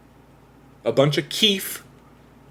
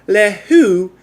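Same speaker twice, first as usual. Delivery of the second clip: high, loud mock-French line; hammed-up French accent; mock-French quote; 8 semitones higher, 7 dB louder than usual. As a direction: toss it off in comic French. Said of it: "now I'm thinking too much about it".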